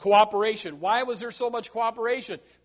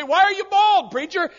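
mock questioner; 12 semitones higher, 10 dB louder than usual. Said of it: "People say".

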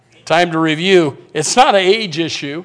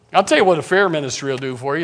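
usual speaking voice

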